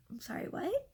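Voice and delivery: high-pitched incredulous question; almost laughing disbelief. Retold or imitated. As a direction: ask it as if on the edge of a laugh. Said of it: "And I, like, almost laugh".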